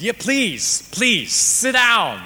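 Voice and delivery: high, loud, rushing him to sit; hammed-up brush-off so he can watch the game; mock impatient shout. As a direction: pastor hamming up his rudeness to a stranger. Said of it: "Not like that, but you get the point".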